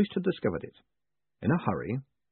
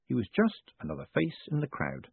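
usual speaking voice

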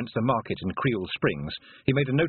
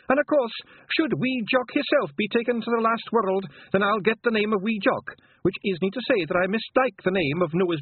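high earnest Scottish pixie; a proud wee Feegle boasting; 10 semitones higher than usual. A narrator's reading. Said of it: "the pixie added".

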